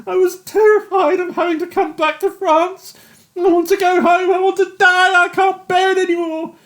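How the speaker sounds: high, loud tearful anguish; a broken man begging to go home; weeping despair. A narrator's reading. Yates weeping confession.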